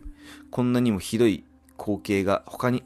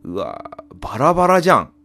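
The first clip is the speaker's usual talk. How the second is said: loud startled outburst; shocked; 5 semitones higher, 7 dB louder than usual. Real, not acted. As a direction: blurt it out in shock. Shocked at the scattered bodies.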